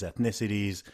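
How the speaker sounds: usual speaking voice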